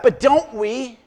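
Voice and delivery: usual speaking voice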